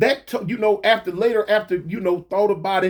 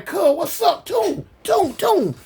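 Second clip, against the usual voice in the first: high-pitched hollering to a friend; retold hollering; 11 semitones higher, slower than usual. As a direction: call out urgently, voice pitched way up. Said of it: "And he hollering".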